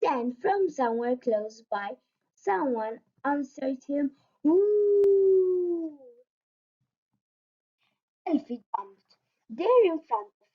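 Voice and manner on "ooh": high, long howl from afar; answering wolf howl. A narrator's reading.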